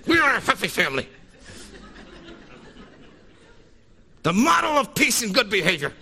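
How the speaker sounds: high, fast, tense hushed yell; whisper yelling. Retold or imitated. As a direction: hiss it at the kids through a fake smile. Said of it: "whisper yelling at my children".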